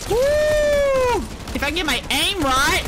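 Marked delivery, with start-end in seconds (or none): 0.1-1.2 high, loud whoop; excited whoop. Real, not acted. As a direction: let out a joyful whoop. Cheer while shooting.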